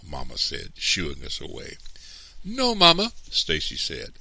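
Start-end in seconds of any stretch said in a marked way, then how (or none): 2.4-3.1 put-on very high, loud child's voice; high-pitched, urgent refusal; protesting boy. A narrator's reading. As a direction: protest like an upset young boy. Boy protests to his mother.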